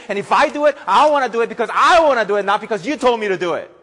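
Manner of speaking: put-on high, loud defiant kid talking back; rebellious child. Child defying parent, loud and defiant. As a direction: whine it like a stubborn teen.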